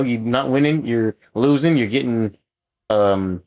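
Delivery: ordinary delivery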